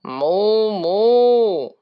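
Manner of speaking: loud, long cow moo; two big storybook moos. A narrator's reading. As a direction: imitate a cow, full and loud. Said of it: "said the spotted cow".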